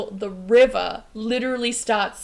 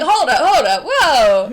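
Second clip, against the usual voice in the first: startled; 4 semitones higher, 11 dB louder, slower than usual. Retold or imitated. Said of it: "You're like".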